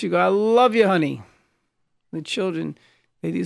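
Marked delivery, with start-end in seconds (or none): 0.2-1.2 loud, higher burst of affection; raised voice. Shown as a demonstration. Spouse answering mean words with love, raised voice. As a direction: call it out at full voice, voice jumping up. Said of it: "raise your voice".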